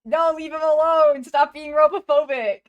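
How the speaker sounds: loud, high mock outrage; indignant shout. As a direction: shout them off in the robot's defence. Defending a robot character from bullying.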